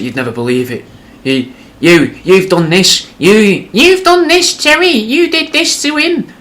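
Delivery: rising to a shrill loud shriek; accusing wife. Acted. Wife to old husband at door, building to a shriek. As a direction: build to a furious screech.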